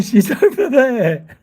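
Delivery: slow, laughing through the words; laughing reply. Retold or imitated. Answering the bloke about his wife, laughing. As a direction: giggle out the punchline.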